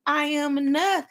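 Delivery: high, rhythmic, dancing-along delivery; gleeful hype to a beat; excited self-affirming. Acted. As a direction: cheer yourself on in a high voice. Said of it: "this is what happened when my affirmation".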